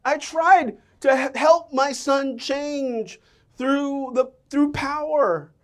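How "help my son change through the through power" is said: slow, drawn-out weight on each word; deliberate slow preaching beat; slow emphasis. Real, not acted. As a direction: lean on it slowly. Slowing for emphasis.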